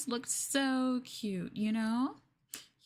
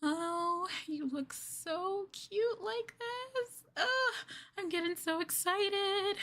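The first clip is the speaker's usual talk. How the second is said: much higher, squealing delight; gushing excitement; 9 semitones higher, slower than usual. Acted. A girlfriend gushing at her cuffed partner, squealing. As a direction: squeal over how cute they are.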